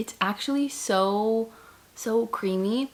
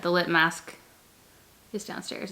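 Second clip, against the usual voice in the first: ordinary talk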